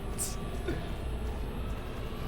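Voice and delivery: unvoiced high wheeze; wheezing laugh. Real, not acted. Streamer wheezing with laughter at the game.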